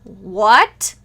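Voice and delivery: loud, stunned exclamation; astonished. Acted. Wife hears husband claim he's the father, astonished. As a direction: blurt it in disbelief.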